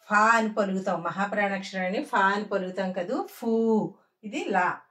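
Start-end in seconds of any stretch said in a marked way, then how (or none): none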